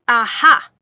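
loud triumphant Aha; triumphant yell. A narrator's reading. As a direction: shout it pointing in triumph. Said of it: "Jens yelled".

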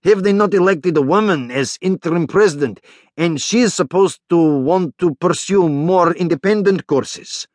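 high-pitched, insistent character voice; Nikolai, vigorous agreement. Acted. Nikolai backs up the major's point, vigorous agreement.

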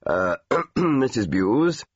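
slow, throat-clearing officer voice; hesitant, official enquiry at the door; formal officer. Retold or imitated. Army officer asking for Mrs Bewes at the front door, slow and formal.

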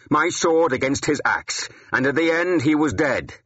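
high, forceful, angry proclamation; rising to shouting. A narrator's reading. Warrior claiming his kill in church, voice rising to a shout. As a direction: raise your voice, driving each phrase home. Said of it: "My voice had risen until I was shouting".

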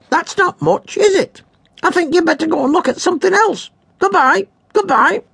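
very high, crackly squawk; crackly parrot voice. A narrator's reading. Parrot demanding snacks from Henry's cat, crackly squawk. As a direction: rasp it out like a greedy old parrot.

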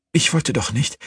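high, helpless protest; distraught protest. A narrator's reading. Woman facing the fire she caused, high and distraught.

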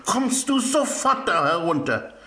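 ein gespielter Vater ruft zornig und höher zu seinem Sohn; wütend schreiend. A narrator's reading. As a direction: streng und laut nach oben rufen, halb gespielt. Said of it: "schrie wütend auf". (Vater ruft seinen Sohn wütend vom Podest herunter, gespielter Zorn)

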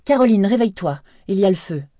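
high, loud urgent alarm; husband waking wife, fire, urgent call. Acted. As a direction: shake her awake with your voice.

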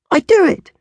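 put-on proud toddler insisting; eager little girl. Little Angel proudly insisting to her sister, very high and eager. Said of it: "she cried".

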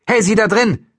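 loud, high shout; calling out. A narrator's reading. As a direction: yell to someone behind a door. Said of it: "Dann rief sie".

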